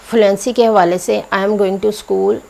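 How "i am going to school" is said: very slow, every word separate; slow written form. Shown as a demonstration. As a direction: spell it out word by word. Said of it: "ऐसे नहीं".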